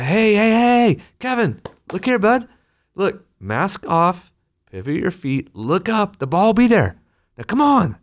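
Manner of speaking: high, upbeat supportive coach voice; pep up the kid kindly; encouraging coach. Retold or imitated. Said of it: "The, the coach comes out and says".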